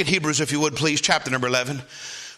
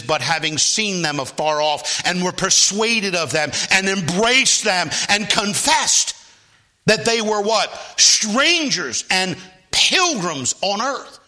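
slowing and swelling louder; building scripture reading; 5 semitones higher, 4 dB louder, slower than usual. Acted. Preacher reading Hebrews aloud, slowing and building to a peak. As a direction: stretch each phrase, climbing.

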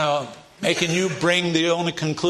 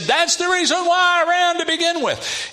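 much higher, whiny sulking prophet; petulant Jonah; 14 semitones higher, 4 dB louder than usual. Retold or imitated. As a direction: whine it like a sulky child caught out. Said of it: "And he said".

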